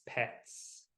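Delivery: usual speaking voice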